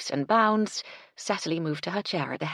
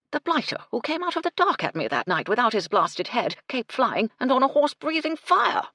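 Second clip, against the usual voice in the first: put-on gruff old colonel, louder and higher, rising to a shout at breathing fire; blustering colonel; 8 semitones higher, 6 dB louder than usual. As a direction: bluster like an outraged old army man. Colonel recounting the headless horseman.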